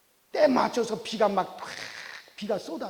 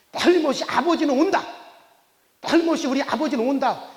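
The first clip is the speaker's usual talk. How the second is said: louder, higher, emphatic conviction; insistent, raised-voice assurance; retold firm belief; 5 semitones higher, 5 dB louder than usual. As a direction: declare it as the child's certainty. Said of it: "그것을 믿었기 때문에".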